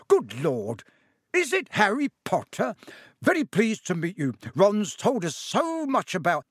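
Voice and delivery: high, eager greeting; delighted. Acted. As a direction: gush with excitement. Delighted to meet Harry.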